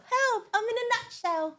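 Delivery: high squeaky cry for help; tiny trapped voice. Pretend. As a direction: squeak it like someone stuck in a nut.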